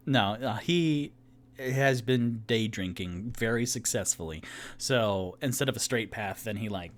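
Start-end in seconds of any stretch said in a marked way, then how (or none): none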